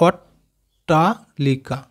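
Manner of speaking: "অট্টালিকা" split as অট, টা, লিকা with pauses; broken into syllables. Shown as a demonstration. Teacher showing both ট to pupils, "অট্টালিকা" cut into slow separate syllables. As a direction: slowly sound out "অট্টালিকা" piece by piece. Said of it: "আমরা কেটে কেটে উচ্চারণ করবো".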